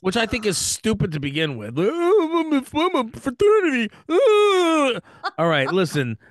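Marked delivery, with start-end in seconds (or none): 1.8-5.0 mocking frat boy.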